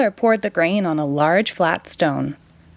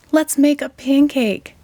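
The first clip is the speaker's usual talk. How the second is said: higher, eager plea; eager boy; 6 semitones higher than usual. A narrator's reading. Jack begs mother for a pancake, eager plea. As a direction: push for the pancake, pitched up. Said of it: "shouted Jack".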